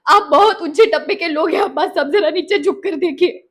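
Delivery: loud, impassioned courtroom accusation; indignant rebuke. Acted. Courtroom rebuke of Abbas and board. (गुस्से भरी ऊँची आवाज़ में फटकार)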